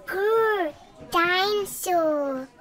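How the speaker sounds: slow, high little growl; toddler dinosaur growl. A narrator's reading. Little brother growling at the class, slow and high. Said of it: "growls George".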